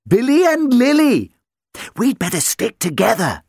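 a bright puppy greeting; little dog voice. Acted. Dog Billy speaks.

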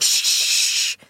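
long, sharp shushing hiss; drawn-out hissing shush. A narrator's reading. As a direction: hush the child urgently. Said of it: "hissed his mother".

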